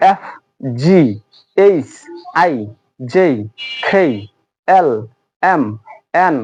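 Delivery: English alphabet, each name separated by a pause; letter-by-letter demonstration. Shown as a demonstration. Teacher asking students to try along, measured letter-by-letter recital. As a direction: recite the letters one at a time for repetition. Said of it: "উচ্চারণ করে দিচ্ছি".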